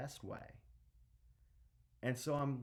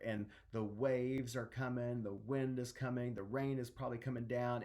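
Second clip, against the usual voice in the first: normal talking voice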